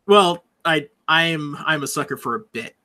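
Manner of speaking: usual speaking voice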